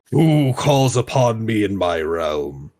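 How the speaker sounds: slow, booming sultan voice; grand languid royal commands. Acted. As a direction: speak like a proud fire lord. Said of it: "I'm having fun doing the Sultan's voice".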